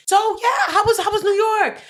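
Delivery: high, loud, laughing subject switch; forced cheery topic change. Retold or imitated. Daughter dodging an argument by asking about New York, forced cheer. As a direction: swerve loudly into upbeat small talk. Acted switch to small talk.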